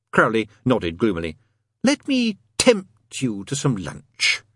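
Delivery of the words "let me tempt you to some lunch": slow, drawn-out hissing invitation, higher pitch; hissed temptation. A narrator's reading. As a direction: tempt him, lingering on the words. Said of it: "he hissed".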